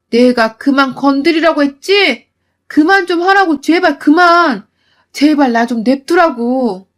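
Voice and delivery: loud, slowing furious plea to stop; enraged shout. Acted. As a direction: yell each word, then drag it out. Wife finally snapping. (한계에 다다른 며느리가 크게 천천히 소리치듯)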